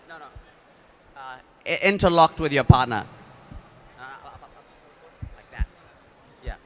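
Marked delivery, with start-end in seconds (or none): 1.8-3.1 much louder over the noise; raised voice over the crowd; loud instruction. Real, not acted. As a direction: call it across the room. Instructing the room.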